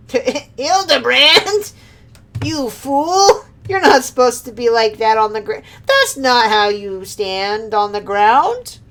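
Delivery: high, hammed-up yelling at a fool; mock exasperated scolding. Villain berating Hildebrand, high and mock angry. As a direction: scold the bumbling inspector.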